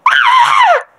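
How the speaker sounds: very high shrieking scream; piercing mock death scream. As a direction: shriek as your character dies. Her character dies in game.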